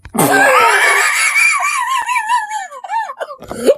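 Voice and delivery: long, high wheezing laugh; uncontrolled laughter. Real, not acted. Streamer cracking up at the old man's accent, real laughter. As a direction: burst out in a helpless high cackle.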